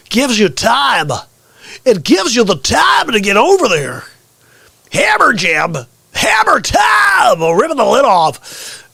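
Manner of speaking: high, shouted show plug; hype announcer. Acted. Sending viewers to the YouTube channel, hype announcer shout. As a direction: belt it out like an arena hype man.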